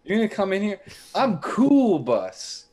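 worked-up, high-pitched comic tirade; pissed off. Acted. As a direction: rant with wounded pride, punching key words. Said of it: "I was pissed".

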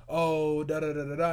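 babbling mom talk in a made-up language; mock foreign chatter. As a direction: imitate mom rattling off in another language. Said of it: "in a different language".

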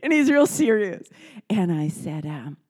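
ordinary talk, nothing marked

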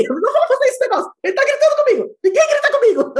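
high, loud indignant retelling breaking into laughter; hammed-up shouted indignation; mock outrage. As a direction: protest loudly, half laughing. Re-enacts outrage at being shouted at.

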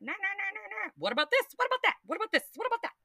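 squeaky back-and-forth twin voices; twins dithering. Pretend. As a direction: bounce between choices like bickering twins. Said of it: "the two twins are like".

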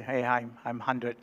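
low, soft and dismissive; the hundred dollar bill's cool reply; aloof hundred dollar. Acted. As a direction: answer with a snob's shrug. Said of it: "the hundred said".

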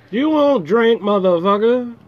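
loud, high teasing yell; teasing shout. Real, not acted. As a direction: call out a friend, loud and teasing. Teasing a friend about drinking.

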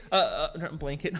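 ordinary talk, nothing marked

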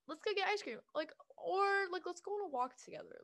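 high, bright friend suggestion; bubbly friend voice. Retold or imitated. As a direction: sing it like an eager friend. Voicing a cheerful friend.

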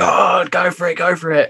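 movie captain's storm yell. Retold or imitated. Captain Dan on the mast goading the storm, mock holler. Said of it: "he's just like".

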